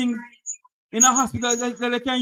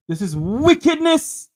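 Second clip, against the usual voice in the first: higher, louder, slow condemnation; indignant; 4 semitones higher, 3 dB louder than usual. Real, not acted. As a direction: condemn it slowly. Host condemning.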